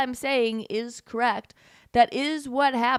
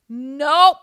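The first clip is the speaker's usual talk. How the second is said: loud, higher-pitched NOPE; emphatic rejection; 4 semitones higher, 11 dB louder than usual. Real, not acted. Host rejecting the article she read, loud and emphatic.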